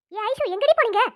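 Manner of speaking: put-on teacher loudly scolds late girls; loud scolding. Teacher confronts returning girls.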